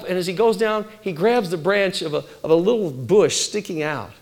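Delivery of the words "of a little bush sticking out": usual speaking voice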